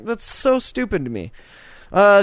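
normal talking voice

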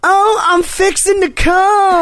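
high, slow southern drawl; imitated southern girl. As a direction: mimic a southern girl, hammed-up and drawn out. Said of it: "I had a southern girl say one time".

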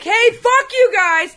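high, drawn-out shout to the room; mock yell at coworkers; demonstrated yell. Shown as a demonstration. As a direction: holler it slowly across the kitchen. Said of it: "I have to yell at a lot of people".

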